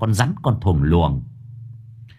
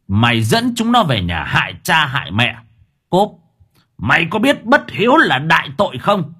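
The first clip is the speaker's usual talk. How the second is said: rising, punchy scolding with raised pitch; angry interrogation; 5 semitones higher than usual. A narrator's reading. Exorcist interrogates ghost child with blows, angry scolding. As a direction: berate and demand answers, climbing higher. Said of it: "nhằm đầu thằng danh con này mà khảo".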